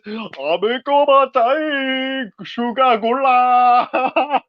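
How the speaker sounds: high, long drawn-out holler; slow, high silly catchphrase call; mock character. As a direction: stretch each word out high, hammed-up. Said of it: "I start doing this".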